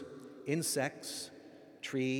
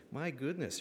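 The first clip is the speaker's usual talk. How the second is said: normal talking voice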